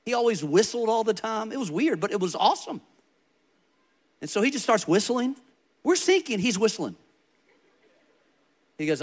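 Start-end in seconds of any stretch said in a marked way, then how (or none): none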